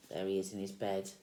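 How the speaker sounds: usual speaking voice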